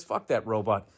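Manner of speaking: normal talking voice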